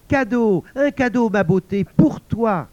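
high, loud, excited call; gushing gift-giver. Acted. La mère offre un cadeau trompeur. (annonce enjouée, aiguë et forte)

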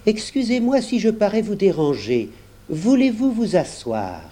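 courteous and mild; very polite. A narrator's reading. Old gentleman greets the room, politely. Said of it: "avec beaucoup de politesse".

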